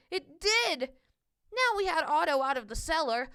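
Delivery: plain reading voice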